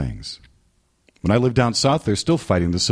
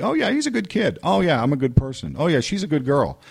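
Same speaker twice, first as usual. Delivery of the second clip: mock self-satisfied; 8 semitones higher than usual. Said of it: "people who basically".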